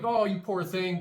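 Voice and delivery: Southern passer-by pitying a stranded driver, hammed-up; mock Southern pity. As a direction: coo it like a Southern neighbour who won't help. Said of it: "the Southern person is nice".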